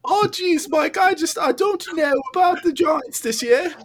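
very high, squeaky cartoon voice; Morty. Morty telling Mike about the Giants, squeaky impression. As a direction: fret in a piping, halting cartoon voice. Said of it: "that's a Morty thing".

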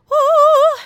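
high whooping cheer; loud joyful whoop; excited whoo. Real, not acted. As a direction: let out a big happy whoo. Excited cheer before top pick.

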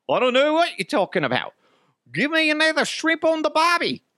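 very high, exaggerated Australian accent; comical Aussie accent. Shown as a demonstration. Man mimicking Aussies for laughs, exaggerated Australian accent. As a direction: ham up a bad Aussie impression. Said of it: "Sorry, Aussies".

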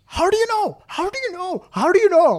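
very high, mock-outraged Indian accent; hammed-up Indian accent, high and repetitive; strong Indian accent. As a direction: imitate an Indian man demanding recognition. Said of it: "in a strong in-, Indian accent".

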